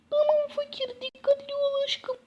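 high, sweet put-on wife voice. Wife sends Fakir off to find work, high loving voice. Husband leaving, wife answers him.

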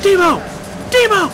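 yelling at the wreck for fun; excited shout. Real, not acted. Cheering on a car crash.

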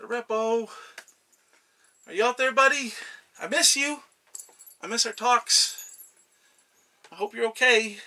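calling out for friend. Acted. God calls out for lost friend.

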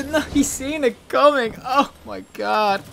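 high, slow, stretched-out lament; drawn-out mock despair. Real, not acted. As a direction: wail it like a tragedy. Repeats the line in mock despair.

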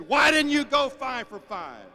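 demanding dad. Retold or imitated. Dad questions son's hits.

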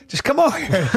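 high, coaxing call across the room. Retold or imitated. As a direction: lure her over with a high call. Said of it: "he goes".

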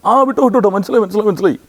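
quick flustered let-them-go; policeman waving them on. Retold or imitated. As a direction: policeman at night checkpoint waving the car through. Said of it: "എന്ന് പറയും".

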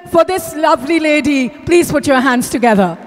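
ordinary talk

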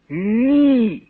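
high indignant cry; refuse, offended. A narrator's reading. Wife to her husband, indignant high cry. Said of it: "обурилася жінка".

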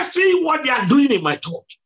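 high indignant retold rebuke; angry divine voice. Preacher voices Jesus pointing at his church, high retold anger. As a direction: start high and press the accusation. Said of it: "cover me from his anger".

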